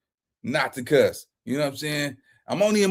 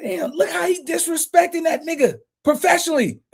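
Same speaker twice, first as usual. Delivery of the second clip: amazed; 9 semitones higher, 3 dB quieter than usual. Retold or imitated. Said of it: "I'm like".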